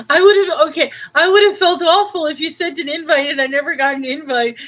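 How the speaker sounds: overwhelmed. Real, not acted. Emotional outburst over invite.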